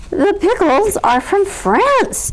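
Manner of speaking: slow, lofty pronouncement; grand declaration. A narrator's reading. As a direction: announce it like a proud host. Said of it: "declared Bear".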